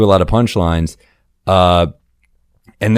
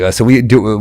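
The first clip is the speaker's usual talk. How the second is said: ordinary talk, nothing marked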